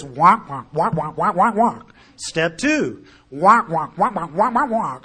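loud muffled trombone wah-wah, no real words; Peanuts adult voice. Retold or imitated. Mocking how AA steps sounded to a newcomer, loud muffled wah-wah. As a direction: honk it out like the unseen grown-ups in Peanuts. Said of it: "when the adults talk in the Peanuts cartoons".

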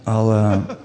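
stifled laugh through the words; real amused chuckle in the voice. Real, not acted. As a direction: try to speak while starting to laugh. Cracks up over untold story.

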